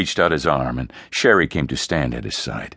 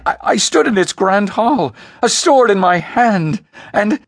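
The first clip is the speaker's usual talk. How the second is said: high, loud accusing outburst; overwrought, stammering self-justification; frantic protest; 13 semitones higher, 6 dB louder than usual. Acted. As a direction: shout your defence at a high pitch. Son hotly defends himself, blaming sister.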